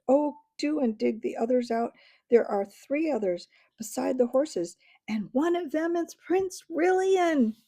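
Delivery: a hint of breathless girl pleading; eager girl. A narrator's reading. Jill urging the Narnians to dig out friends, eager.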